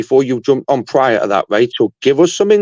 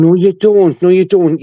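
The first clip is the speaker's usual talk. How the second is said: raised voice, cutting him off twice; loud flat denial; 5 semitones higher, 4 dB louder than usual. Real, not acted. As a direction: shut the claim down hard and loud. Heated argument, flat denial.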